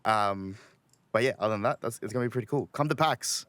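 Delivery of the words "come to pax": ordinary talk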